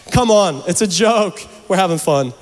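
ordinary talk

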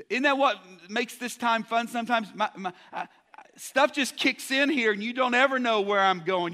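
ordinary delivery

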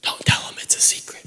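hushed whisper; secretive stage whisper. Retold or imitated. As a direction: whisper it urgently, keeping a secret. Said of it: "why are you whispering".

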